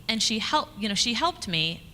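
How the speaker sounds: ordinary delivery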